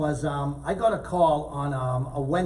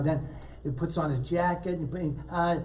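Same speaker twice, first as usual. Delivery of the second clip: usual speaking voice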